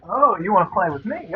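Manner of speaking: normal talking voice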